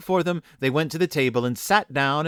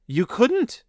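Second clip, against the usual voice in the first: put-on high, rising children's disbelief; crying out; 11 semitones higher than usual. A narrator's reading. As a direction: gasp the question up high. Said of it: "they cried".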